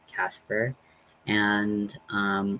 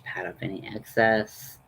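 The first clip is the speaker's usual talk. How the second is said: usual speaking voice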